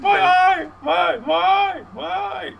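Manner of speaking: very high, loud wailing; hammed-up shrieking sobs of a hurt kid; mock crying wail. Boy punched in the mouth, imitated high wailing cry.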